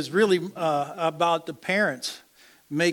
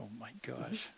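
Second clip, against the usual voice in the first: whispered; 5 semitones lower, 17 dB quieter than usual. Real, not acted. Whispered aside during vows.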